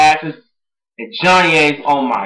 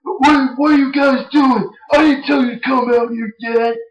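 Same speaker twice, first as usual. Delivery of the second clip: much higher mock shout, caught off guard; flustered boss; 9 semitones higher than usual. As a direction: play a boss whose plan went wrong. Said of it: "Johnny's like".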